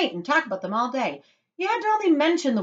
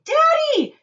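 put-on very high, loud child's yell; shouting; 10 dB louder than usual. A narrator's reading. Spoilt girl calling her father, shouting. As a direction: shriek out for attention. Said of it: "shouted Veruca Salt".

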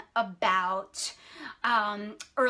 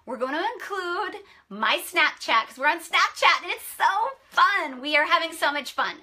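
higher, louder, bubbling over about Snapchat; eager, higher and louder hype; excited gushing; 6 semitones higher, 4 dB louder than usual. Real, not acted. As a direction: sell it like it's the best thing ever.

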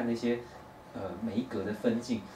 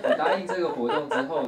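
ordinary delivery

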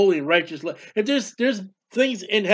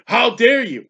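louder, higher mock outrage; imitated affronted protest; mock indignation; 5 semitones higher, 5 dB louder, slower than usual. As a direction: act out someone taking offense. Acting out people offended by God.

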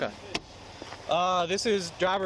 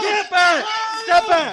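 sharp loud order, much higher and louder; shouted command; 10 dB louder, much slower than usual. Real, not acted. Officer to onlookers, shouting to step back. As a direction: shout to push the crowd back.

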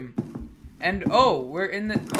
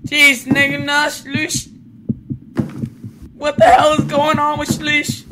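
loud, high-pitched alarm; rattled yelling; 9 semitones higher, 9 dB louder than usual. Real, not acted. Player protesting after a jump scare, rattled and yelling. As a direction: cry out in real fright.

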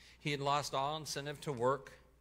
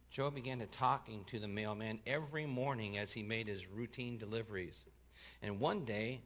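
usual speaking voice

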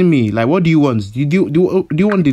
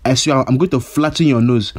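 ordinary talk, nothing marked

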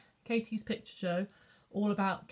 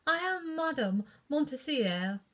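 French lady; 7 semitones higher than usual. A narrator's reading. French lady introduces herself.

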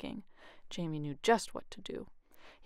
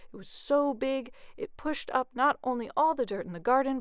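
usual speaking voice

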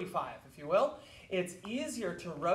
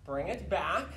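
usual speaking voice

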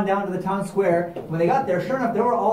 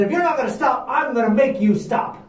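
menacing threat; 7 semitones higher, 3 dB louder than usual. Acted. Ricardo threatening with shotgun.

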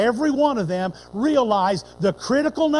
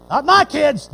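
indignant denial; 5 semitones higher, 8 dB louder, slower than usual. Retold or imitated. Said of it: "the same tone of voice".

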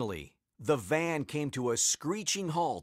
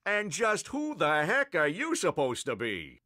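higher-pitched police chief's challenge; angry demand; 4 semitones higher than usual. A narrator's reading. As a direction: demand it with indignant authority. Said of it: "the police chief demanded".

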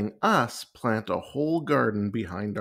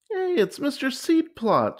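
high-pitched excited yell; 3 dB louder than usual. A narrator's reading. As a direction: call out in alarm at spotting him. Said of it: "she yelled".

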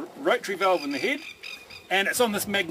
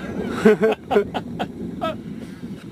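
laugh; 3 dB louder than usual. Real, not acted. Motor stopped, laughs.

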